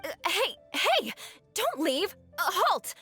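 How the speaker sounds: high-pitched, halting protest; flustered calling out. Acted. Snubbed noble calling after a departing townsperson, flustered. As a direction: call after someone walking off, pitch jumping up.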